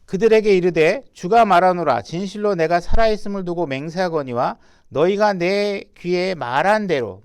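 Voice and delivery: loud, solemn Bible reading at full voice; scripture reading. Acted. Preacher reading God's oath to the congregation, loud and solemn. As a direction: proclaim the verse like a preacher.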